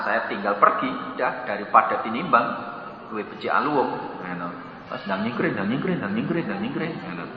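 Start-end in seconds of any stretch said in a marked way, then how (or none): 4.9-7.2 much softer, hushed repetition; sneaking off. Retold or imitated. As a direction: fade out like slipping away. Abdurrahman kabur diam-diam. (berbisik pelan sambil menyelinap pergi)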